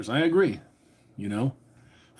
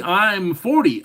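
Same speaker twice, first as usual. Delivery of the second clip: ordinary talk, nothing marked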